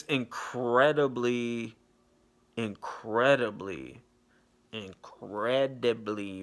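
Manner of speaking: slow, savoured repetition of each word; drawn-out emphasis. Real, not acted. Gushing to viewers over a beautiful actress, slow and emphatic. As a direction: stretch every word out with pauses between.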